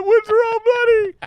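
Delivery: very high, put-on voice; hammed-up audition line. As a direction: squeal the line out like a bad audition.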